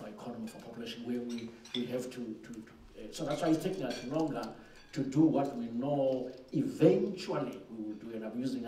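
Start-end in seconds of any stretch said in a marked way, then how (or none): 4.9-8.1 slow and louder, leaning hard on "eventually"; stress on eventually. Shown as a demonstration. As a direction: press the word "eventually" so it lands. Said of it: "I'm emphasizing the word eventually".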